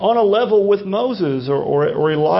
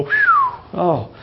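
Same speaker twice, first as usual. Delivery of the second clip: high whistled sigh of mock relief; hammed-up phew of relief; relieved whew. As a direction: blow out a high "whew", then drop to "oh". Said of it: "that's a relief".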